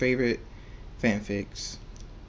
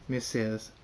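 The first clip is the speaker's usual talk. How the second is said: plain reading voice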